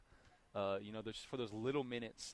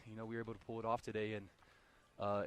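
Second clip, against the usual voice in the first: normal talking voice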